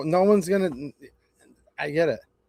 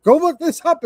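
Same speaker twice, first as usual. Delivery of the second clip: ordinary talk, nothing marked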